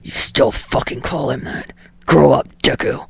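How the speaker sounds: low, breathy sneer; low sneering whisper then a jab. Acted. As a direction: scoff at him. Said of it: "he sneers".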